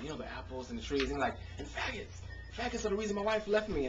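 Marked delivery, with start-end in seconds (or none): 1.6-3.9 high, fast mock rant of a ranting poet; lightly hammed-up preacher-style outburst; mimicking the open mic poet. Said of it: "he's like".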